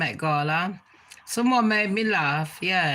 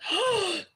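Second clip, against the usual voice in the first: shocked gasp. Acted. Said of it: "shocked reaction act".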